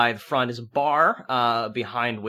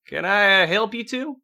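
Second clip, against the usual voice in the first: lightly voiced barman, higher pitch, rising question; 7 semitones higher, 3 dB louder than usual. Barman greets two parched newcomers, higher-pitched role voice. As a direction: play a saloon keeper greeting strangers, pitched up.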